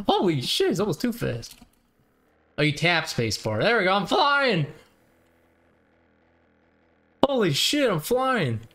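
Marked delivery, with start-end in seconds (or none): 0.1-1.6 high excited exclamation; thrilled outburst, pitched up. Real, not acted. Flying the gyrocopter. 3.6-8.6 high, loud thrilled shouts; yelling with delight, pitched up; excited. Real, not acted. Finally airborne.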